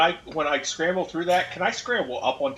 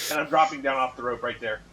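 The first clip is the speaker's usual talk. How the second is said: normal talking voice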